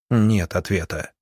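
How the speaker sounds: usual speaking voice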